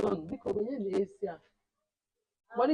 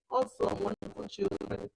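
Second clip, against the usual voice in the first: ordinary talk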